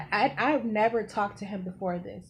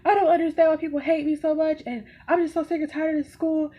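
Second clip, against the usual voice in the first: much higher, louder, upset wail in words; imitated crying outburst; 8 semitones higher, 6 dB louder than usual. Student venting to whoever consoles her, imitated tearful outburst. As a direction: act out venting through hard tears. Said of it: "crying so hard".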